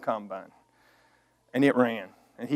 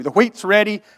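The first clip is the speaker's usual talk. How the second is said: louder mock rant, blah blah; retold hollering; 3 semitones higher, 7 dB louder than usual. Son ranting at his dad over the wheat, hollering. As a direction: rattle it off hollering. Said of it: "I'm hollering".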